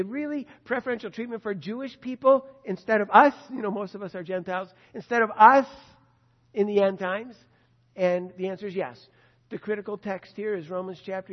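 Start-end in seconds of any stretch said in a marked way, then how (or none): 0.0-3.3 incredulous objection. Retold or imitated. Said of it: "And we say". 4.9-7.3 louder, disbelieving question; loud incredulous objection. Retold or imitated. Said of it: "And we say".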